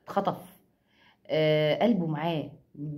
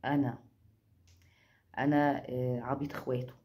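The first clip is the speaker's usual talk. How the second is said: arrogant swagger; 5 semitones lower, 4 dB quieter than usual. Retold or imitated. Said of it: "بيعمل حتة التعجرف دي بقى".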